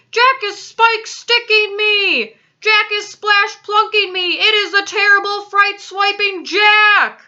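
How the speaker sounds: high, loud, drawn-out wailing shouts; terrified bellowing. A narrator's reading. Giant tormented by Jack in a nightmare, terrified bellowing. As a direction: bellow each cry in panic. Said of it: "bellowed the flesh lump eater".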